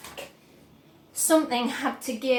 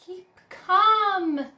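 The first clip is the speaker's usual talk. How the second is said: raised, louder soothing cry; cried; 7 semitones higher, 7 dB louder than usual. A narrator's reading. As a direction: call out to calm her. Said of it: "cried Mister Wonka".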